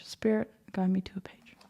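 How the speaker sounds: hushed whisper, almost no voice; whispered plea. Real, not acted. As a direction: whisper it like a small prayer to spirit. Asking spirit to pick a page.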